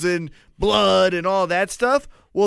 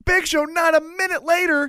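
excited shout; 9 semitones higher than usual. Real, not acted. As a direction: shout it out in awe. Hyped retelling of Lesnar feat.

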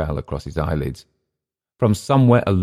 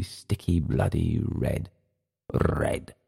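sinking from soft to a breathy whisper; drunken reverie trailing into a whisper; fading to a whisper; 6 dB quieter, much slower than usual. A narrator's reading. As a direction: let the word red die away slowly. Clift's voice sinks to nothing.

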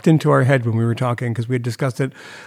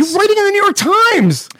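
high-pitched, incredulous yell; exasperated outburst; 4 dB louder, faster than usual. Real, not acted. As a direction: heckle with pitch shooting way up. Heckling the article's author.

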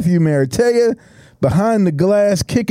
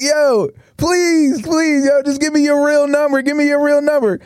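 higher, pleading "please, please"; begging guy; 7 semitones higher than usual. Retold or imitated. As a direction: beg the driver's passenger for the number. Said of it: "And he's like".

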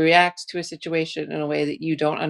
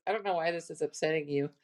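sarcastic; 8 dB quieter, faster than usual. Shown as a demonstration. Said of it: "how we're gonna say is".

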